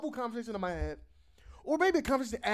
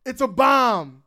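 loud announced warning; retold yell; 3 semitones higher, 9 dB louder, slower than usual. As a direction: raise the voice on the yell. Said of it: "So I yell out".

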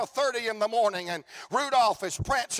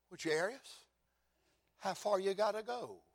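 quiet question; 10 dB quieter than usual. Acted. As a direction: ask it gently, almost under the breath. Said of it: "I hear somebody say".

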